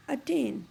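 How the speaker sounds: "attain" with an unstressed "at" and stressed "tain"; stress on second syllable. Shown as a demonstration. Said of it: "putting the stress on the second syllable".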